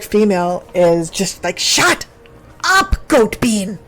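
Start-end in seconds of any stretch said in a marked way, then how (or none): 1.6-3.8 mock snapping. Half-orc female snaps at male.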